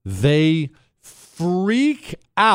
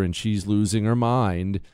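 ordinary talk

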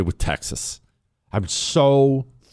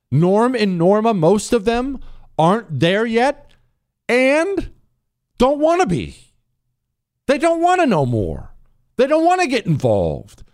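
mock Norm and Norma; 11 semitones higher than usual. Mocking disengaged voters.

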